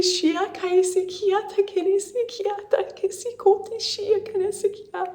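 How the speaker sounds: soft, hushed channeling voice with strange syllables; quiet spiritual transmission, fading to near silence; channeled message. Acted. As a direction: pass on a gentle message from beyond.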